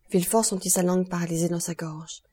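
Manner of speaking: plain reading voice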